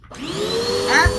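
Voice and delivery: startled yelp. Real, not acted. Startled by the dog.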